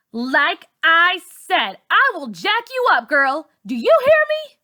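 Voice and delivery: slow, loud, very high furious threat; menacing yell. A narrator's reading. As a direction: spit the threat out, screaming. Char threatens Malika again.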